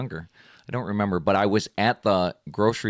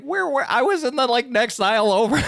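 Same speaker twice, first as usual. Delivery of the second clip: much higher, breezy imitation; grandma's casual reply; 13 semitones higher than usual. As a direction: shrug it off like grandma. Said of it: "she's like".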